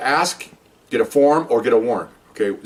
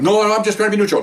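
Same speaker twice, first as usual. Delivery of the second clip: higher, louder hurried excuse; captain protesting he is only neutral, nervous; 8 semitones higher, 3 dB louder, faster than usual. Retold or imitated. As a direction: back off, flustered and defensive.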